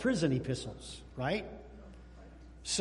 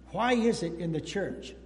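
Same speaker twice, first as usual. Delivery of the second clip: usual speaking voice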